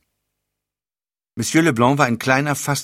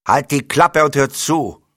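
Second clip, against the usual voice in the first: louder, slow, higher angry rebuke; snapping at him; 5 semitones higher, 6 dB louder than usual. Acted. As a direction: cut him off hard. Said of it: "fuhr ihn Leblanc an".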